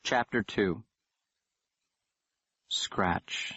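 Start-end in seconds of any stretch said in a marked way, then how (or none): 2.7-3.5 breathy whispered scratch; eerie whisper. A narrator's reading. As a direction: hush it like a scraping sound.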